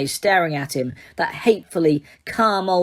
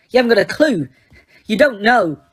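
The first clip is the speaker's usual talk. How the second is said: louder, higher, bitter; angry teen; 4 semitones higher, 5 dB louder than usual. A narrator's reading. Harry lashing out at Dumbledore, angry and louder. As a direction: hurl it back at him.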